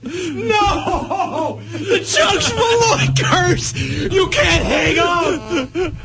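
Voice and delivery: high crying shout; anguished shouting. Real, not acted. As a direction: wail in outraged despair. Hang-up ruins the curse.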